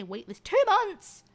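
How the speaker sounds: high, loud, slow shriek of disbelief; squealed disbelief at the wait; mock outrage. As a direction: shriek it like it's absurd. Mock shock at the flyer.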